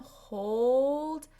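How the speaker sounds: slow, stretched out; held sound. Shown as a demonstration. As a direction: stretch it out slowly. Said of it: "what I do with my students".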